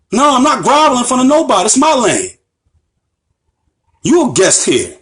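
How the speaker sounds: fast, high-pitched, indignant retort; indignant refusal. Real, not acted.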